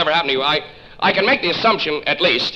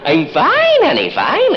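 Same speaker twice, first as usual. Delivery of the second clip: high, bright fake cheer; forced cheer; 11 semitones higher, slower than usual. Caller faking cheer for a toddler on the phone. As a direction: put on sugary cheer.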